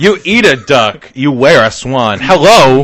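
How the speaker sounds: loud, high indignant protest; mock-indignant shouting; mock outrage. Mock outrage over swan.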